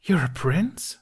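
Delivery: high astonished question; gasping surprise. A narrator's reading. The woman realizing Hiccup is royalty, high and astonished. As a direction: catch your breath in disbelief. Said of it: "the woman gasped".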